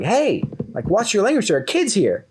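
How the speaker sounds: high-pitched mock shout, no real volume; retold scolding shout. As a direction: imitate an outraged mother calling out. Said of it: "she, like, shouts at him".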